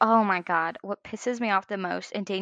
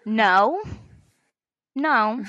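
firm retold refusal; 6 dB louder than usual. Refusing husband's offer, retold.

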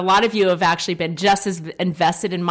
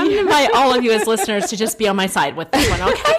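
laughing; 5 semitones higher, 5 dB louder, faster than usual. Real, not acted. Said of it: "laughing appeal to listeners".

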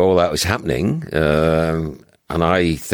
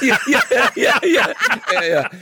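laughing. Real, not acted. Laughing after the joke.

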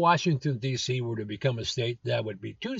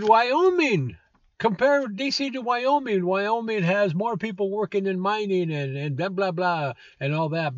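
higher mocking voice of the senator; hammed-up mock politician; mocking Cotton; 6 semitones higher than usual. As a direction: mimic a pompous senator. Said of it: "he says really stupid things".

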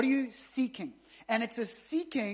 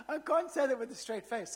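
real laugh breaking through; amused, laughing aside; 4 semitones higher than usual. Real, not acted. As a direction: chuckle through the words. Laughing at archaic phrasing.

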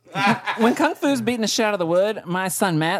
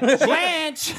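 mock calling out; 7 semitones higher than usual. Acting out calling for Blanche.

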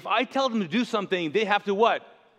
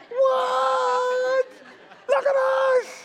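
high, loud astonished cry with a laugh; shrieking in astonishment; amazed shout; 4 dB louder, much slower than usual. Shown as a demonstration. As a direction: cry out in wonder. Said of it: "Which literally translates".

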